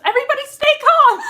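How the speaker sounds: high, loud and fast, laughing; excited squeal. Real, not acted. Reading comment, excited.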